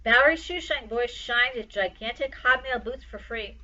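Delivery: plain reading voice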